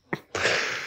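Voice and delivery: short airless snicker; a silent, scornful little laugh; breathy laugh. Acted. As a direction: laugh under the breath at them. Teasing the listener.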